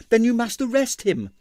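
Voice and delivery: high, urgent, desperate pleading; distressed dancer urging the inspector; pleading ballerina. A narrator's reading. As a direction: beg him to understand, voice up high. Ballerina demands.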